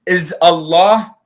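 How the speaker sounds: normal talking voice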